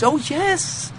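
high, bright-eyed happy acceptance; gladly accepting. Retold or imitated. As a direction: play the devotee gladly taking criticism. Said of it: "take it with great happiness".

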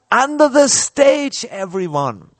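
high, loud shouted order; commanding shout. Retold or imitated. Leader to panicked crowd as grenades explode, commanding shout. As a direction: shout it like a general's child taking command. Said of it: "I shouted".

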